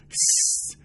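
sharp voiceless "shh"; warning shush. A narrator's reading. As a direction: hush your companion urgently. Warning shush as someone approaches. (هیسِ هشدارآمیز)

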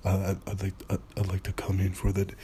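low, soft, hesitant mumble; mumbling teen. Retold or imitated. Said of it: "Speak up, man".

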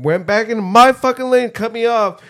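raising the voice telling it; heated rant. Real, not acted. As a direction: vent the road rage hard. Fuming about being cut off.

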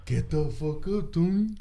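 slow mocking imitation of a friend; lightly voiced friend telling him to get up; imitating Andrew. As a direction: drawl it out as the friend did. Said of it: "Fucking Andrew".

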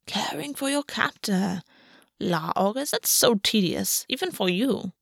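soft, slow, muttered scorn; under her breath. Acted. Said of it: "said under her breath".

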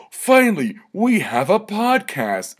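high, loud, slow mock cheer; mock Trump supporter. Mocking Trump fans finding his podcast, high hammed-up cheer. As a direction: ham up a fan's giddy relief. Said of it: "Trump supporters are gonna be like".

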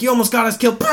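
high-pitched, exasperated imitated scolding; mock angry dad. Dad yelling at his son in the car, mock anger.

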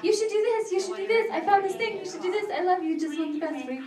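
high, eager nagging mom voice; pushy mother. Retold or imitated. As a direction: push it like an overbearing mom. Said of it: "pushy mother".

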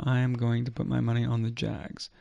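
ordinary talk, nothing marked

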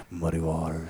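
low, quiet gravelly bluesman voice; muddy voice. Shown as a demonstration. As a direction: growl [name] low and soft. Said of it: "I'm gonna say it like that".

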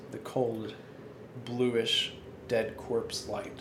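slow, hushed dark fantasy narration; story narration. Acted. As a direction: draw the players into the eerie scene. Game master scene setting.

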